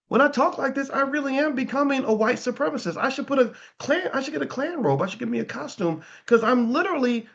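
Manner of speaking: panicked self-realization. Retold or imitated. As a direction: squeak out the alarm at his own words, a voice far up from his own. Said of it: "and then I was like".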